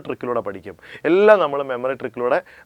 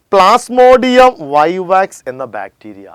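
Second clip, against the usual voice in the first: slow, loud and higher dictation; emphatic dictation; 5 semitones higher, 9 dB louder, much slower than usual. Shown as a demonstration. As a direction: announce the name slowly and loudly. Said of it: "എന്താണ്?". (ഉറക്കെ പതുക്കെ പേര് പറയുന്നു)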